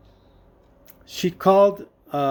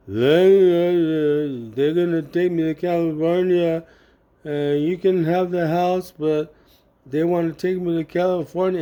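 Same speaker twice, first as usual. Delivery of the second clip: put-on drugged, drunk-sounding mother. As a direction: stretch the words out woozily, as if drugged. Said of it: "she's all drunk and high right now".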